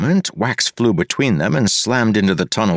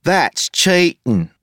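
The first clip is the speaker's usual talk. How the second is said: high, slow exaggerated street accent; mocking parody of Wayne's accent; 10 semitones higher, much slower than usual. A narrator's reading. Taunting Wayne mid-duel, exaggerated accent. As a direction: lay the parody on thick. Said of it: "exaggerated parody of his accent".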